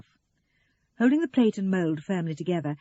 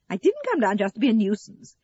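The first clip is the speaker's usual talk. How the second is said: higher, louder self-righteous protest; 4 semitones higher, 6 dB louder than usual. A narrator's reading. Eleanor interrupting her busy daughter, righteous. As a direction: cut in with wounded righteousness. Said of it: "Eleanor interrupted righteously".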